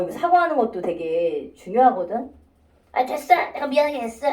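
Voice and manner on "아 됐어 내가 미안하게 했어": mock grudging sorry, pitched up a bit; huffy, insincere apology, acted out; grumbling apology. Shown as a demonstration. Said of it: "막 이렇게 투덜투덜거리면서".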